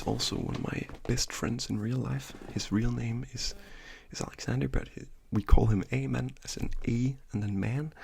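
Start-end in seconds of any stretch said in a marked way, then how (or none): none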